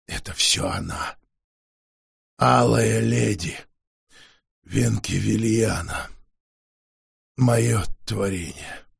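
low, slow, quiet vampire; exhausted vampire forcing out words; weary vampire voice. Acted. Wounded vampire speaking.

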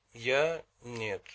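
ordinary delivery

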